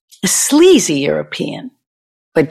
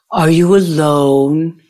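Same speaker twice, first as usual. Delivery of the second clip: put-on slow, drawn-out German accent; German-accented whisperer. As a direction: imitate a German man's slow come-on. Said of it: "I heard the German accent".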